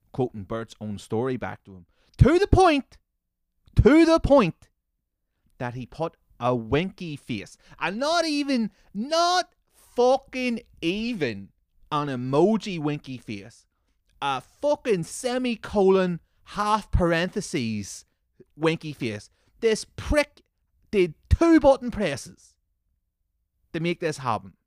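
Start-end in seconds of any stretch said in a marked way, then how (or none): 2.2-4.7 high, loud, exasperated cry; high-pitched ranting shout; exasperated outburst. Real, not acted. Exasperated rant at silly post. 7.8-24.5 high, drawn-out disbelief; slow, high-pitched indignant ranting; exasperated rant. Real, not acted. Ranting about a typed emoji.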